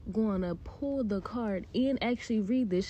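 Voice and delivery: ordinary talk, nothing marked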